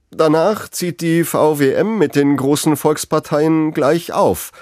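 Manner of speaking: moderator struggling for composure. A narrator's reading. TV moderator announcing a shock result, a hint of strained composure. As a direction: keep a lid on the shock as you announce. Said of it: "hörbar um Fassung bemüht".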